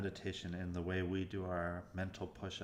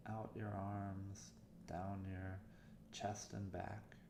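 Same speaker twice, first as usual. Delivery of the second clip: softer meditation voice with long pauses; guided meditation; 7 dB quieter than usual. Acted. Meditation coach leading listeners through warm-up exercises, guided meditation voice. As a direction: guide listeners gently, leaving room between phrases.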